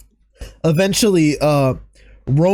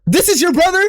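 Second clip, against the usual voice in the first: mock Jimmy taunt; 9 semitones higher than usual. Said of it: "turns over to Jay and said".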